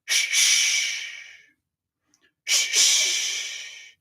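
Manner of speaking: unvoiced "Shh, shh" pulsed from the belly; shh breaths. Shown as a demonstration. Leader breathes along with singers, hissed shh. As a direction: hiss out short puffs. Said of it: "Now you".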